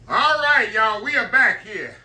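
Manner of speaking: loud, high welcome back; excited greeting. Real, not acted. Host greeting viewers back after the break, loud and excited. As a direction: burst back in front of the camera, pumped.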